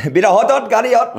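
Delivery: high, loud hammed-up Indian accent; mock Indian accent. Pushed by mates for an accent, mock Indian accent. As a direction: blurt a silly Indian accent, laughing. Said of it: "Give him, give him one".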